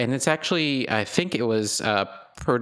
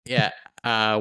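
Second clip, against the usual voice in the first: ordinary talk, nothing marked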